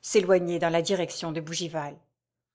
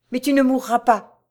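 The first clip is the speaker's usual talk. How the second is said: raised, louder plea; cried out; 5 semitones higher, 6 dB louder than usual. A narrator's reading. Raoul cries out to dying Jeanne, loud and pleading. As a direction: cry it out in protest. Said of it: "s'écria Raoul".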